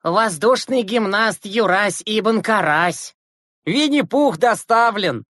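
slow, high, showy mock announcing; jeering announcement. A narrator's reading. Classmates joining in to tease Yurasik, mock fanfare. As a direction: announce him like a circus act. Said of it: "подхватили Загоркин и Симак".

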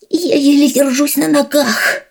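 high squeaky shaken mouse. Acted. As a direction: squeak it out weakly. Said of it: "писклявым голосом выдавил он".

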